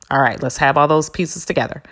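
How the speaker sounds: ordinary delivery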